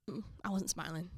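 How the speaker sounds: a whispered correction under the breath; whispered aside. Real, not acted. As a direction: drop to a whisper for a quick self-correction.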